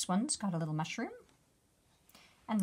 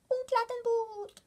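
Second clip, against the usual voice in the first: excited bug cry; 13 semitones higher, 4 dB louder than usual. Acted. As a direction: cry out in wonder like a tiny bug. Bugs cheering.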